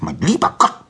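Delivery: high, exasperated fox voice; barking fox. Acted. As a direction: bark it in irritation. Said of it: "bellte der Fuchs".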